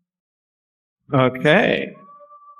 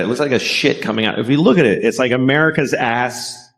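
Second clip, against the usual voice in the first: ordinary talk, nothing marked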